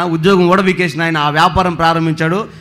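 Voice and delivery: ordinary delivery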